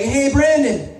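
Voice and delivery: high, friendly hail; retold call-out. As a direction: mimic someone hailing an acquaintance.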